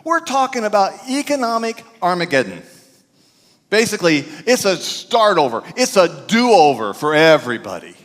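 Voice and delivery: high, loud, rousing sermon delivery; full-force preaching. Acted. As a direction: preach it to the back row. Preaching the good news list.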